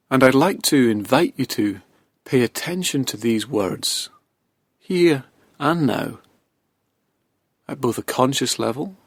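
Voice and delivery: hypnotic induction. Acted. Begins hypnotic relaxation induction.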